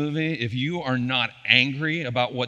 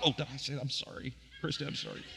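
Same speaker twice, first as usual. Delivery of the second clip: much softer, embarrassed aside; sheepish apology; 11 dB quieter, faster than usual. Real, not acted. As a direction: murmur an apology to your wife. Pastor catches his own swear.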